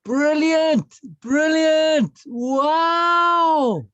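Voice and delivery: high, loud delighted cry; amazed wow. Real, not acted. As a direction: exclaim with big delight. Reacts to childhood proposal.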